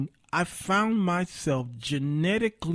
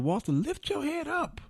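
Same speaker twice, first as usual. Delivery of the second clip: high-pitched, softer urging to himself; bold inner voice; 11 semitones higher, 5 dB quieter than usual. Retold or imitated. Boldness telling him to face the woman, high urging. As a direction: lift it high, like a prodding inner voice. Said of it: "boldness came over me and said".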